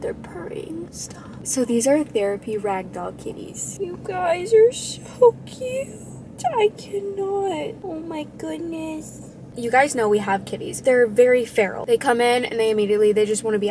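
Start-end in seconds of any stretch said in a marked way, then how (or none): 0.0-1.5 soft hushed whisper; whispered cooing. Real, not acted. Owner whispering over purring kittens. 3.8-9.3 cooing. Real, not acted. Owner cooing tenderly at her new kittens.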